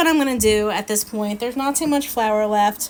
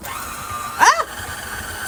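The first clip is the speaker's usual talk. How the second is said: strained cry. Real, not acted. Straining at heavy dough.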